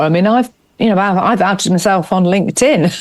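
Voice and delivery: usual speaking voice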